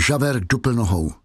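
plain reading voice

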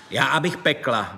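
plain reading voice

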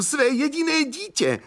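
very high, wailing exclamation; distraught cry. A narrator's reading. Young man cries for his only child, distraught cry. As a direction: wail it high and desperate. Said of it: "křičel znovu".

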